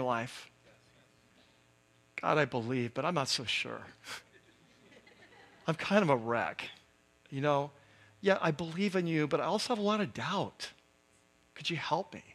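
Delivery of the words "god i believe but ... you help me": hushed, low confession of doubt; honest prayer. Acted. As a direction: confess softly, with a small rueful laugh. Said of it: "feel so honest".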